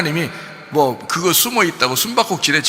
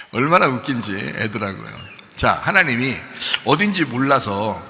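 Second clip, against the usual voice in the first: usual speaking voice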